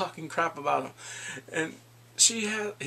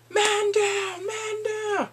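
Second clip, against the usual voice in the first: mock alarm call; 4 dB louder, much slower than usual. Someone shot Minhyuk.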